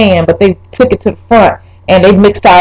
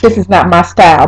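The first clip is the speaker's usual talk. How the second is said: ordinary talk, nothing marked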